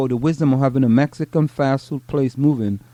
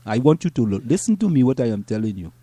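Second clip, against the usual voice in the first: put-on African accent; 2 semitones higher than usual. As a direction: hold the African accent and tease. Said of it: "I have a very good, uh, African accent".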